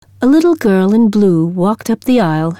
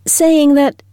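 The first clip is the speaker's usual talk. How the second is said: embarrassed; 6 semitones higher, slower than usual. Acted. Embarrassed teacher explains fiancé's telegram.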